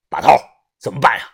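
low, serious question to the boss; sober, deep-toned query. A narrator's reading. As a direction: ask gravely, dropping low. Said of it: "于哥沉声地问道".

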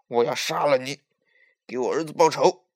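loud threat through gritted teeth; seething, forceful death threat; vengeful fury. A narrator's reading. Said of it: "牙齿咬得咯咯响".

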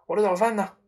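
lightly barked demand; calling out. Acted. Father calls to the kitchen for breakfast, demanding.

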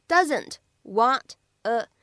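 "doesn't want a" with each word separate; "doesn't want a" in isolated full words. Shown as a demonstration. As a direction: pause between "doesn't", "want" and "a". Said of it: "这样的三个单词呢".

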